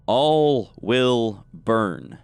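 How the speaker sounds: very slow, each word drawn out; ominous proclamation. Acted. Game master reveals words carved in enormous letters, slow and portentous. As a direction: intone it word by word, stretched long.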